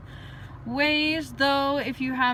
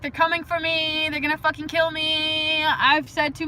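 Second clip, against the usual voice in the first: mock paranoia; 5 semitones higher, 5 dB louder than usual. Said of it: "I just wanna be like".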